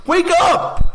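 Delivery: real high, loud shouted alarm; urgent shout. Real, not acted. Radio host warning women about creeping bans, urgent high shout. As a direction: shout it to rouse the listeners.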